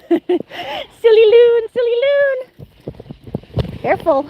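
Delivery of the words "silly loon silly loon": high, slow, drawn-out pet talk; delighted teasing. Real, not acted. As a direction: coo at the silly dog. Teasing the dog.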